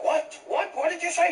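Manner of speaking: very high, incredulous cop; baffled policeman. Acted. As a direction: imitate an officer demanding a repeat. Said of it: "this guy was like".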